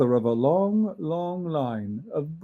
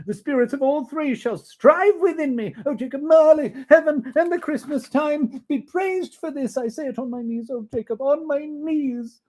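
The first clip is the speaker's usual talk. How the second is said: put-on high, loud, fervent old man; Scrooge giving thanks; 10 semitones higher, 6 dB louder than usual. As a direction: pour out thanks on your knees.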